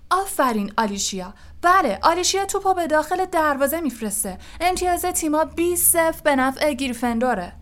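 high, loud cheering commentator; excited match commentary. Acted. Lee announces Alicia's goal to the stadium, excited commentary.

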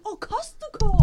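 loud, high, drawn-out "oh, now I get it"; exaggerated Japanese-style cry of recognition; mock realisation. Locals finally understand the teacher, mock loud realisation.

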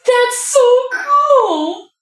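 high, slow, laughing delight; excited laugh. Real, not acted. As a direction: squeal it out with a laugh. Player thrilled by frog genie.

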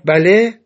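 «بله» said loud and firm; emphatic yes. A narrator's reading. Asked about faith in God, emphatic «بله». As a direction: punch «بله» with full conviction. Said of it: "با تأکید گفت".

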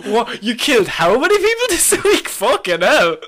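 high, loud thrilled wife; excited. Retold or imitated. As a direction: gasp it out, thrilled.

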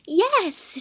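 high, loud excited scream; a sudden delighted scream; screamed yes. A narrator's reading. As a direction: shriek it out for joy. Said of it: "she screamed".

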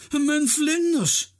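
high, slow, loud cry of dismay; shouted. A narrator's reading. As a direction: wail it out like a robbed collector. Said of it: "schreeuwt hij".

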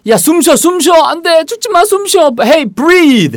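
high, frantic cry to breathe; desperate pleading. Acted. Friend begging a dying Tom, frantic and high. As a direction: beg him to breathe, panicked.

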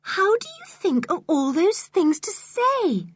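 put-on very high, slow, girlish wonder; admiring. A narrator's reading. Girl to Julian after his story, admiring. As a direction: marvel at him in a high voice. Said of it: "said Anne admiringly".

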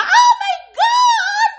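very high, loud, slow squeal of delight; gushing fan screaming a greeting; excited shriek. Retold or imitated. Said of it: "Kommer någon framspringande, bara".

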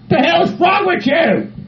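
high, drawn-out indignant yell; affronted outburst. Acted. Patient challenging people making funny faces, affronted high yell. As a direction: stretch it out slowly, high and outraged.

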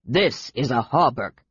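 lightly voiced low, stubborn dwarf; insistent dwarf. A narrator's reading. Dwarf insists amid laughter.

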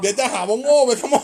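laughing loud and high while joking. Real, not acted. Cohost jokes about looking dumb, laughing. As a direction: laugh through the words.

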